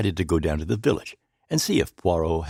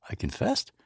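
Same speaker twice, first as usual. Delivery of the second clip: ordinary delivery, nothing marked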